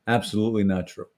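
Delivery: ordinary talk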